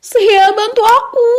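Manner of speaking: high, drawn-out slow cry; high pleading shout; urgent call for help. A narrator's reading. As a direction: call out for help, stretching the words. Girl calls for help in fight.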